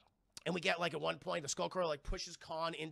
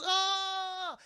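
falling scream; 13 semitones higher, 6 dB louder than usual. Retold or imitated. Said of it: "She goes".

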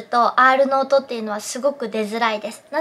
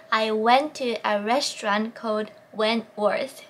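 calm drill repeat; slow practice again; 2 semitones lower, much slower than usual. Shown as a demonstration. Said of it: "四回口を縦にしないといけない".

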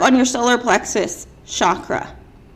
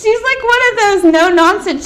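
ordinary delivery

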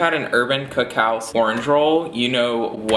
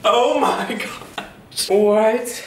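mock shock; 9 semitones higher, much slower than usual. Real, not acted. Reacting to her old L A dream.

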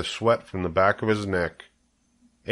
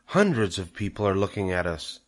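hushed, anxious confiding; frightened whisper; 4 dB quieter than usual. A narrator's reading. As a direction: murmur nervously about being watched. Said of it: "whispered Missus Zuckerman".

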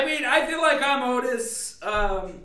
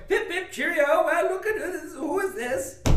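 teasing mock posh British accent, higher; 7 semitones higher than usual. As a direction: ham up a British accent to tease a friend. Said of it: "make fun of you and your English accent".

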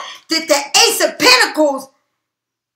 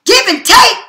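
louder, higher vow to win; determined outburst; 4 semitones higher, 5 dB louder, slower than usual. Retold or imitated. Reader voicing the listener's determined cry, loud and high. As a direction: spit it out like a promise to yourself. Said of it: "You said".

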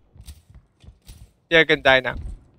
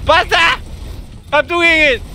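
excited gamer shouts; 6 semitones higher, slower than usual. Real, not acted. Player yelling at the game mid-fight, excited shouts. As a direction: shout out in the heat of play.

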